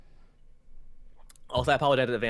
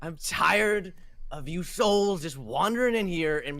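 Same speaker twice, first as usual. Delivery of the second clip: slow, higher fed-up complaint; exasperated ship captain; 8 semitones higher, 8 dB louder than usual. Acted. Captain tells souls why he resents them, slow exasperation. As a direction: draw out the weariness with visitors.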